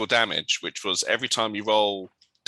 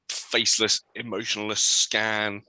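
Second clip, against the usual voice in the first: ordinary talk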